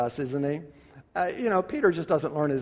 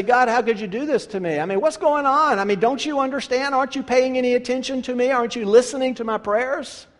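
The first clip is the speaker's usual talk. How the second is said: higher, louder indignant protest; mock complaint at God; 8 semitones higher, 6 dB louder than usual. Believer complaining to God, indignant. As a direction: shake your fist and demand answers. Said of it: "we shake our fist at God and we say".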